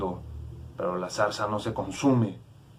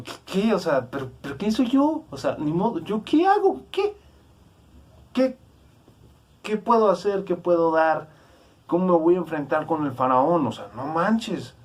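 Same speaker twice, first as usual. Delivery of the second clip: hammed-up panic, high and spluttering; Moses, panicked disbelief; 7 semitones higher than usual. Moses protesting his calling to face Pharaoh, acted-out panic. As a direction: blurt it in a high, mock-flustered voice. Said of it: "Moisés se queda así como".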